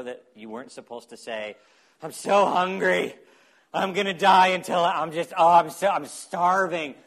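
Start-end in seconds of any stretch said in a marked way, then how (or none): 2.0-6.9 loud, complaining mock moan about hunger; mock whiny faster. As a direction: ham up a starving complainer, louder. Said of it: "maybe not in that tone".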